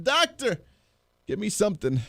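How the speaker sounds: pleading Doctor, jumping up high then dropping; a hammed-up whiny patient asking for pills; mock patient begging. Host mocking a patient begging the doctor, pleading.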